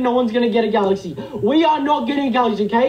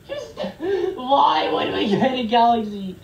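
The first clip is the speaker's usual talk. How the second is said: slow, whimpering complaint; tearful whine; slower than usual. Real, not acted. Streamer lamenting an unwanted galaxy gift, tearful whine. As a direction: whimper it out as if close to tears.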